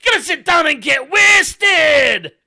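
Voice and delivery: screamed, high-pitched Scottish bellow; full-volume mock-Scottish pub invitation; loud Scottish accent. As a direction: yell it like a boisterous Scotsman. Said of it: "They're so loud. It's like".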